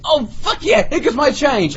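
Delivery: high-pitched triumphant outburst; mock elation. Seeing her come back with change, mock elation. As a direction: celebrate to yourself in a high voice. Acts out his thought on her return.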